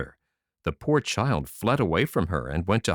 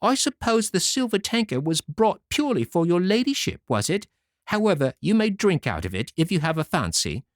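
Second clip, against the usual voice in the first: much higher, haughty retort; proud and saucy; 11 semitones higher than usual. Acted. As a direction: sneer at the lady. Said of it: "said the proud saucy one".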